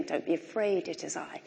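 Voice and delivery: Jesus' words, hushed and gentle; quiet. Shown as a demonstration. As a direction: let it fall to a hush. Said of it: "the heart of the whole thing".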